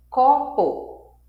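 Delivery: "copo" parted into two syllables; sílaba por sílaba. Shown as a demonstration. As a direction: say "copo" as two separate puffs of air. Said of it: "duas sílabas". ("copo" silabado, em duas batidas)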